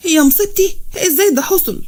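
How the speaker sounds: high-pitched, distressed exclamation; acted dismay. Mother hearing the child was kidnapped, acted dismay. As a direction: cry out as if disaster struck.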